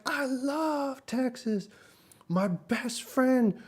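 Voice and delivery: very high, slow mock accent voice; imitating the cook. Vlogger imitating the cook loving Texas, high slow mock voice. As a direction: stretch it out high like the enthusiastic cook. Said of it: "He's like".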